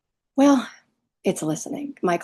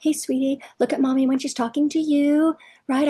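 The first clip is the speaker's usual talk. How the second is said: imitated coaxing mommy, pitched up on the endearments; cajoling parent; 3 semitones higher than usual. Mommy asking Timmy to pick up toys, mock cajoling. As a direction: plead sweetly, as a parody of a pushover parent. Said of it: "begging, cajoling, cajoling, pleading".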